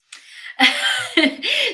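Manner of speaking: a high, bursting laugh; laughing hard at her own dog story; high burst of laughter. Real, not acted. Vlogger laughing at her dog's bath story, high laughter.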